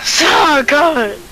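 high happy exclaim; excited. Real, not acted. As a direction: cheer it out gleefully. Laughing at a fitting song.

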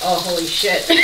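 usual speaking voice

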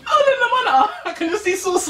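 high laughing surprise; giggling at herself. Real, not acted. Sees sauce on her lip.

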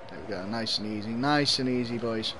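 soft, low murmur to the team; quiet coaxing. Real, not acted. Streamer settles his players on the ball, quiet coaxing. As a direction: keep them calm under your breath.

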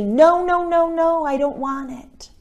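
pleading refusal. Acted. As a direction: push the gift away in a rush.

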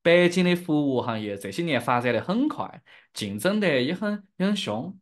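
Chengdu-accented Sichuanese Mandarin; demonstrating a Sichuan dialect accent. Shown as a demonstration. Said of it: "if I use my dialect, that should be like this".

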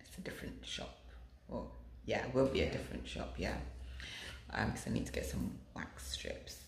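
unvoiced hushed aside; whispering. Real, not acted. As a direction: whisper it to the camera.

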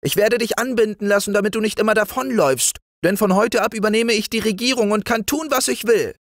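little frog prince yelling at his friend; shouting frog prince. Acted. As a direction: scold him shrilly as a rascal. Prince scolds, threatens.

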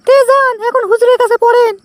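high put-on woman's voice; wife's voice. Young wife shooing her husband out, in a high put-on voice. Man voicing his wife.

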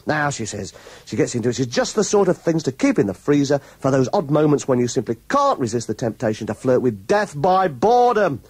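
normal talking voice

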